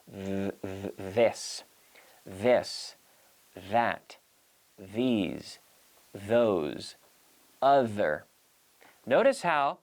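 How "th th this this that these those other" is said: "this", "that", "these", "those", "other" with a voiced th and the tongue out; voiced th drill. Shown as a demonstration. Teacher drilling "this", "these", "those" for students with a voiced th. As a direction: buzz the th in "this" and "that" with the tongue between the teeth. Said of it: "We say".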